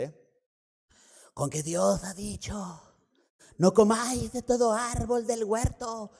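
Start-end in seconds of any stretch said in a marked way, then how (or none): 1.3-6.1 hissing serpent voice, high and sly; snake voice. Acted. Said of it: "voy a hacer mi voz de serpiente".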